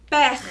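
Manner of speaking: "Per" with a long throaty friction R; strong R. Shown as a demonstration. Teacher demonstrating the strong sound, strong R. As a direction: let the R of "Per" rasp on. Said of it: "escuta o som forte".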